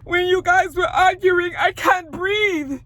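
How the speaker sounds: high, loud, drawn-out fake sob; mock crying. Vlogger mimicking a distressed castmate to her friend, hammed-up crying. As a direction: wail it slowly, imitating someone too upset to breathe.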